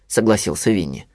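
usual speaking voice

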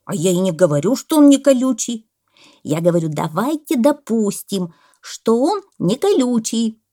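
loud, exasperated insistence; shouting parrot. A narrator's reading. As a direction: yell the point, climbing on the key words. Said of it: "закричал попугай". (кричит попугай)

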